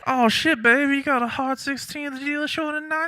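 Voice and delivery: high, loud, fast street hustler voice; a wild crackhead pitching blackjack tips, way up high; hammed-up crackhead. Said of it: "crackhead giving advice".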